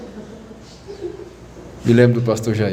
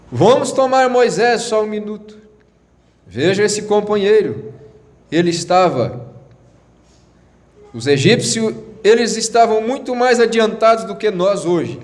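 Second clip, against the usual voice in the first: high, declamatory sermon voice; insistent raised preaching tone; emphatic preaching; 8 semitones higher than usual. Acted. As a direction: proclaim it from the pulpit, pitched up. Preaches about Moses.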